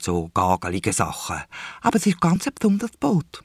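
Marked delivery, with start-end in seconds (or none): 1.8-3.4 put-on pestering little girl. A narrator's reading. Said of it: "müdet Isa weiter".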